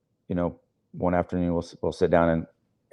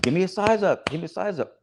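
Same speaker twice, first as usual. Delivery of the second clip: mock yell; 11 semitones higher than usual. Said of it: "yell in his face".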